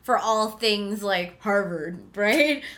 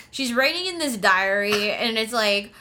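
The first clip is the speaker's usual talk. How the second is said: ordinary talk, nothing marked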